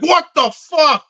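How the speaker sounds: high, loud mock outburst; imitated yell. Imagining the man's temper on set, mock yelling. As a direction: yell it like an angry boss, mockingly. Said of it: "I'm sure he would yell".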